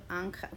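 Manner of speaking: "ángel" as ÁN-gel, quietly settled; weight on ÁN in "ángel", soft ending; stress on first syllable. Shown as a demonstration. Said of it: "I like the sound of".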